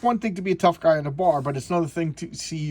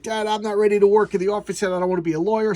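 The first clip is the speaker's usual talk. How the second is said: higher, louder mock protest; protesting son; 6 semitones higher, 7 dB louder, faster than usual. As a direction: imitate a son pushing back at his dad. Son protesting to his dad.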